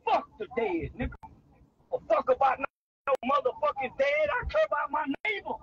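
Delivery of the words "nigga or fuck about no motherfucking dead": usual speaking voice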